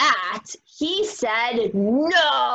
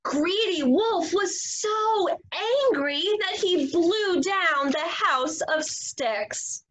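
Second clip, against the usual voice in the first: usual speaking voice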